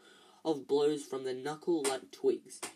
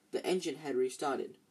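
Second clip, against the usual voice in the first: usual speaking voice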